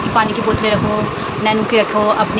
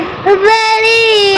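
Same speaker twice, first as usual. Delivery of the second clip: put-on long, high, sung-out whine of a child; whiny child mimic; 11 semitones higher, much slower than usual. Mother mimicking her daughter's whiny request for water. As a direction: stretch it high like a whining toddler.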